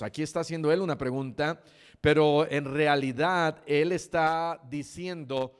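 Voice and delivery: ordinary talk, nothing marked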